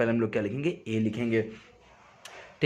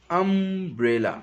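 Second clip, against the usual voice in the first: Umbrella said slowly and a little higher; slowed pronunciation; 5 semitones higher, slower than usual. Shown as a demonstration. Teacher slowing the word to show its vowel start. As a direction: linger on the अ of umbrella.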